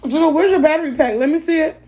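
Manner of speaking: high, loud, fast call across the house. Real, not acted. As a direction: shout to someone in another room. Calling out to Juno across the house.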